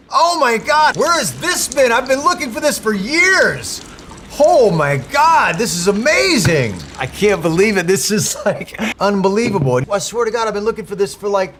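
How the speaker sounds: very high, gushing squeal over a treasure; squealing excitement. Acted. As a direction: gush like a kid who found his lost toy. Overexcited squealing over a found game.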